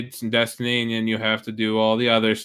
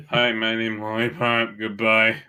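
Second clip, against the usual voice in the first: impression of Lollipop; slower than usual. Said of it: "That's even worse".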